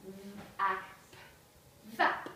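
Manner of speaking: nonsense word broken into separate letter sounds; phonics-style segmenting of an alien word; sounding out. Shown as a demonstration. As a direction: sound out each piece with clear gaps. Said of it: "They would sound them out".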